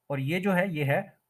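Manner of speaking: normal talking voice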